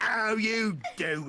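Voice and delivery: high, drawn-out mock-British greeting; bad English accent. Tourist greeting a constable, bad English accent. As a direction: stretch it in silly English tones. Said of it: "bad English accents".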